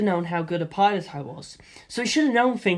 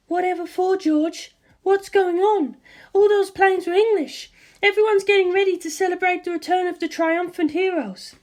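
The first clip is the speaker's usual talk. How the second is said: higher, puzzled mother; 12 semitones higher than usual. A narrator's reading. Mother on the phone asks her son what is going on, puzzled. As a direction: voice the mother, confused and cheerful.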